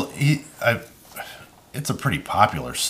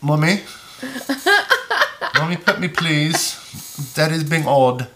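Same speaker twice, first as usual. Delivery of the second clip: lightly voiced pleading pet, a bit higher; dog voice; 7 semitones higher than usual. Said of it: "Now you're doing the dog voice".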